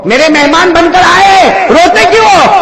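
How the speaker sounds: loud, high preaching that builds with each number; full-force preaching. Acted. Preacher counting the prayers down to the crowd, loud and rising. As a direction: call it out to the crowd like a fiery preacher.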